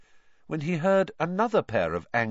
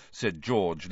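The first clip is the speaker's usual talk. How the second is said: usual speaking voice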